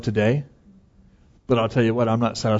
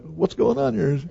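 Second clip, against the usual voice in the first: higher-pitched puzzled reaction; imitated baffled question, pitched up; mock bewilderment; 8 semitones higher, slower than usual. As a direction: act out being taken aback. Retold startled reaction to yell.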